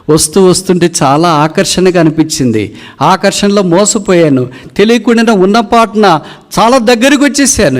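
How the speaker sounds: very high, building intensity; anguished eagle confession. Acted. Eagle confesses to hunter its fatal lure, climbing anguished pitch.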